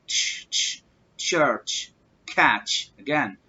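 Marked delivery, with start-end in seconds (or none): 0.1-0.8 "تش" as a voiceless tch, no vowel; isolated CH sound. Shown as a demonstration. Teacher drilling the ending for learners, bare voiceless "تش". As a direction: let out just the consonant "تش", twice. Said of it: "لا، church".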